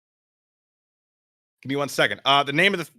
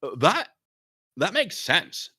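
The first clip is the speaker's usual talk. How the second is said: doubtful; 9 semitones higher, 6 dB louder, slower than usual. Acted. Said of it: "Jan said doubtfully".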